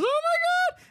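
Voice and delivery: very high, delighted squeal in imitation; mock thrilled gasp. Carrie seeing Big come down the stairs, mock thrilled squeal. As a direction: imitate a woman lighting up with delight. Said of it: "she has this glow up where she goes".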